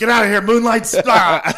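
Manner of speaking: high, loud hammed-up outburst; mock furious yell. Cohost acting out friend attacking the Moonlights, mock yelling. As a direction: yell it like a reality-show brawler, ending in a scream. Imitating friend throwing wine in fight.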